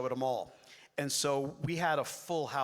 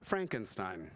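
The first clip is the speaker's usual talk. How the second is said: low, deflated repeat of Frankenstein; deadpan disbelief; 7 semitones lower, slower than usual. Acted. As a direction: drop Frankenstein low, as if stunned. Stunned repeat of the insult.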